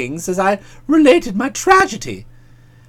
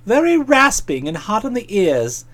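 plain reading voice

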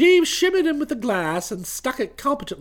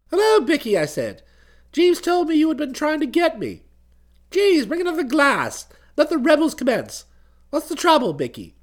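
put-on breezy young gent; 6 semitones higher than usual. Bertie welcomes Bicky into the flat, breezy and higher. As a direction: greet an old pal with cheery bounce.

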